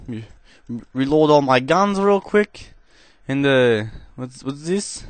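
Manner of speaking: cheesy put-on Russian accent held through gameplay chat; deliberately hammy Russian accent, never dropping it. Gamer commentating to viewers in a cheesy Russian accent. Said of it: "trying to perform a Russian accent".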